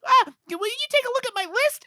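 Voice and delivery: put-on very high, eager child voice; little boy voice. Little boy finds Santa in the hallway, in a very high eager kid voice. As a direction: squeak it out like an excited kid. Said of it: "the little boy walks".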